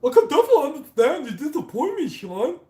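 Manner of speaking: very high, loud, rushed support-agent parody; ridiculously squeaky, loud mock sympathy; mocking Google support. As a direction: squeal it fast like a fake customer-service agent. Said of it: "say it like a Google support".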